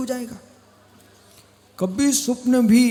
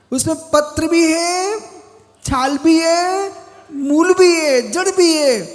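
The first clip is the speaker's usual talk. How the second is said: raised, ringing sermon delivery; declamatory preaching; 7 semitones higher, 6 dB louder than usual. Real, not acted. Monk listing the parts of a healing plant, declamatory preaching. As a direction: preach it out high, stretching the listed items.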